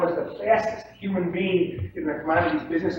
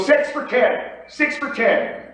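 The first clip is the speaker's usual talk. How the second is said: loud, confident pit call; raised voice bid; 8 semitones higher, 5 dB louder, slower than usual. Shown as a demonstration. Trader bidding at raised decibel level, loud. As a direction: project it firmly with no wobble. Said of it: "if they increase their decibel level".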